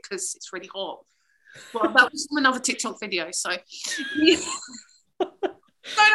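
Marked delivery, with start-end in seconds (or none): 3.5-6.1 words squeezed through a high laugh. Real, not acted. Admitting the fact came from TikTok, laughing. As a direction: say it while still laughing.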